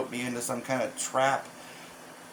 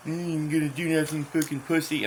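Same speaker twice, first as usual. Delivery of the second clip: imitating a sly, smiling taunt; put-on needling voice, said through a smile; 3 semitones higher than usual. Tormentor needling him so others can't hear, mock smiling taunt. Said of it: "he'd say it with a smile on his face".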